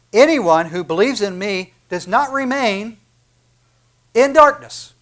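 proclaiming scripture. Acted. Proclaiming Jesus' words.

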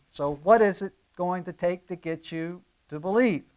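ordinary talk, nothing marked

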